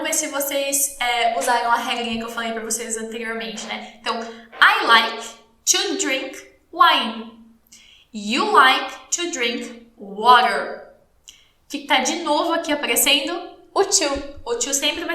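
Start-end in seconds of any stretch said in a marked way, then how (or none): 4.2-7.4 very slow, spaced-out teacher English; slow model sentence. Shown as a demonstration. 8.1-10.9 very slow English, word groups held apart; slow model sentence. Shown as a demonstration. Teacher models an English example.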